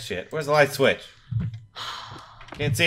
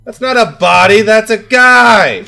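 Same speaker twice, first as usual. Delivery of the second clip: panicked yell; 6 semitones higher, 9 dB louder than usual. Real, not acted. Fleeing the killer.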